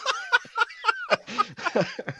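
rising high giggle. Real, not acted. Laughs at own joke.

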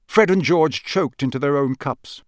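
ordinary delivery, nothing marked

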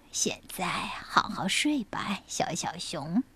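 low, soft goodnight whisper; soothing whisper. Acted. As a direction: lull a child to sleep. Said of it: "接着". (温柔的晚安低语)

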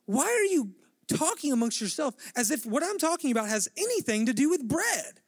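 high, incredulous, pushing the question; a mock exasperated teacher; exasperated disbelief. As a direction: voice Jesus baffled at his disciples. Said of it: "he's basically like".

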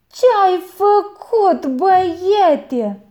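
high, loud, slow cry of dismay; aunt sees the jug smashed, high and loud; shocked aunt. A narrator's reading. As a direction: gasp it out in horror.

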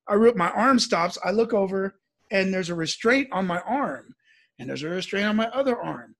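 ordinary delivery, nothing marked

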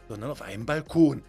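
plain reading voice

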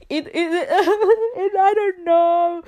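real flustered laugh through the words; laughing nervously about her own accent. Real, not acted. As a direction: giggle it out, a little embarrassed.

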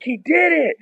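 high, thrilled celebration; excited cheer. Retold or imitated. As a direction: cheer like the hit just landed. Celebrating the home run.

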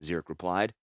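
usual speaking voice

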